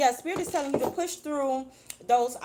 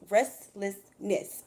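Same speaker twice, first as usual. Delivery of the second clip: restlessness drawn out syllable by syllable; each syllable of restlessness stretched out; said really slow; much slower than usual. Shown as a demonstration. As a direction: say restlessness very slowly. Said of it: "I have to say that word really slow".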